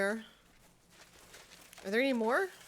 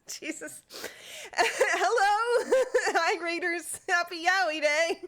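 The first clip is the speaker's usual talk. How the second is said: excited; 7 semitones higher than usual. Real, not acted. Greeting raiders, laughing.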